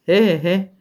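put-on a mean, creaking old man's snicker; spiteful creaky laugh. A narrator's reading. Joseph gloating at the cousins, creaky spiteful laugh. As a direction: cackle with malice. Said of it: "un râs răutăcios și scârțit".

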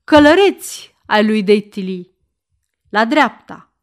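loud, ringing command to the troop; military order. Acted. The count ordering his horsemen to turn right, a loud command. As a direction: call the cavalry like an officer on parade. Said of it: "ordonă".

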